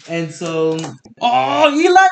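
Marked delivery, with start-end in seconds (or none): 1.2-2.1 loud, high greeting called across the room; big hype welcome shout; excited shout. Real, not acted. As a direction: hail your buddy as he walks in. Greeting friend who just returned.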